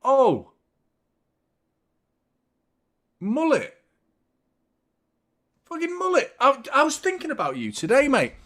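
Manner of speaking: high, loud delighted shout of recognition; excited greeting. Real, not acted. Greeting Mullet as he turns up in chat, thrilled shouts.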